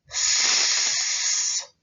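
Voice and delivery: long unvoiced hiss of S; a steady voiceless s sound. Shown as a demonstration. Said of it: "Repeat after me".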